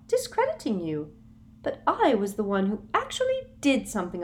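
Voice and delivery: high, rising to loud gloat; a sugary villain closing in; Umbridge gloating. A narrator's reading. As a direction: Umbridge gloating over Harry. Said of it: "Umbridge gloating".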